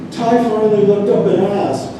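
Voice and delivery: storytelling verse in careful, separated phrases; poem reading. Acted. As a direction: recite the anecdote as a poem to an audience. Reads own poem aloud.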